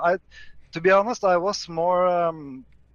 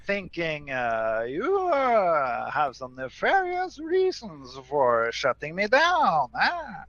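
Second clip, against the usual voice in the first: impression of Fred; 2 semitones higher, slower than usual. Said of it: "I did not know I sounded like that".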